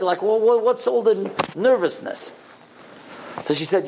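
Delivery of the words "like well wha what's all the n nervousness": high-pitched, stumbling, baffled question; puzzled questioning. Retold or imitated. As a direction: ask in bewilderment, pitch raised. Asks lady about her nervousness.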